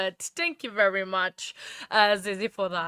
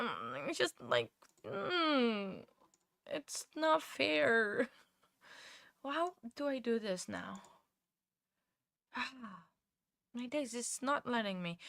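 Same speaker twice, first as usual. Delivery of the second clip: frustrated muttering; 11 dB quieter than usual. Real, not acted. Frustrated muttering at glitchy app.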